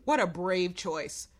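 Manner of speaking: ordinary talk, nothing marked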